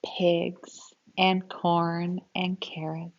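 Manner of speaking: put-on lively, expressive read-aloud for kids; storybook reading. Teacher reading a grandpa story to children, expressive read-aloud. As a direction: read it like a picture book to a class.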